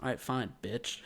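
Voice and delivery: low-key, very soft aside; muttered insult. Retold or imitated. Fed-up clerk to rude customer, muttered. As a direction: let it slip out under the breath.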